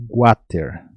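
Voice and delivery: "water" said as GWA-ter, a g-sound before the w; say water the Paraguayan way. Shown as a demonstration. Teacher demonstrating Paraguayan "water" to students: gwa-ter. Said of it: "vou colocar a pronúncia do water aqui".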